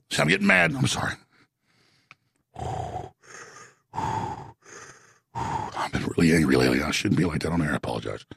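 calm down and apologize, dropping low; deflated, low-pitched apology after an outburst; subdued apology. Real, not acted. Catches himself and apologizes after ranting.

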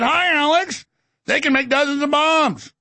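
high-pitched mock bunny voice; Easter Bunny. Easter bunny tells Alex about bombs, mock cute. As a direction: chirp it high like a cartoon bunny. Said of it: "little Easter bunny pop".

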